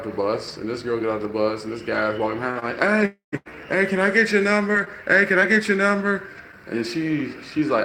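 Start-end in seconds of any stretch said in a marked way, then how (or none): 2.8-6.2 high, loud pestering call; imitated calling out. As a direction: call after her, imitating a pushy guy. Man calling after a girl.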